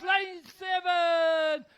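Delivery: lane seven bellowed slow and high; shouted. Real, not acted. Shouting third place at finish.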